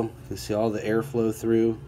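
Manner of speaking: ordinary delivery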